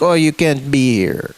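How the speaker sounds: low, curt mock cop voice; imitated cop. Retelling cop ordering him.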